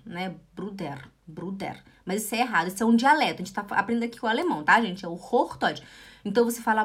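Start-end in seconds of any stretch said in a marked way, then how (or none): 0.5-1.8 low, soft Swiss "Bruder" with final R; Swiss German. Shown as a demonstration. Teacher illustrating Swiss dialect, softly, "Bruder" ending in "der". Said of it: "O suíço, ele fala Bruder".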